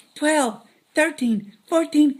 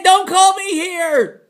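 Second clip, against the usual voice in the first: louder and higher, dragged out slowly; slow angry repeat; 5 semitones higher, 8 dB louder, much slower than usual. Acted. Irritated host repeating a warning slowly, higher and louder. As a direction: spell out each word with annoyance. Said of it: "I said".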